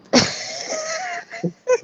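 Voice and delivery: sudden high-pitched burst of laughter. Real, not acted. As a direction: crack up at what you just read.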